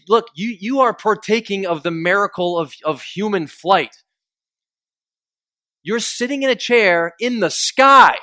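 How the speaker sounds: high and loud, slowing on "human flight" and "in the sky"; impassioned marvel. Retold or imitated. Comedian telling a passenger to marvel at flight, loud and awed. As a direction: preach the wonder of flying.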